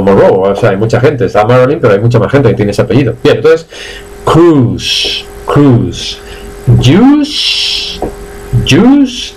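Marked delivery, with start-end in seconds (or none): 4.2-9.3 "Cruise" and "Juice" as long "crus", "yus"; silent I drill. Shown as a demonstration. Teacher drills learners, "Cruise" and "Juice" with silent I. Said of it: "repetid conmigo".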